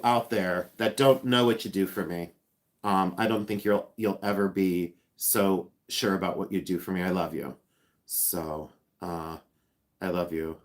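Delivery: low, soft and sincere; tender thanks, voice dropped low and quiet; heartfelt. Real, not acted. Thanking Missy for sharing her loss, heartfelt and soft.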